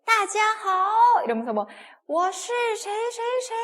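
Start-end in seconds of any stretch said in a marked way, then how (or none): 0.0-1.2 high, loud, stretched-out hello in Chinese; mock star hailing the crowd; shouted stage greeting. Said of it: "소리 질러 이런 거 할 때". 2.1-3.5 high, stretched-out stage announcement; slow, high-pitched crowd call; performer introducing themselves. Acted. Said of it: "막 이러면서 인사해요".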